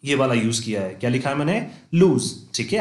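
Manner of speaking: ordinary talk, nothing marked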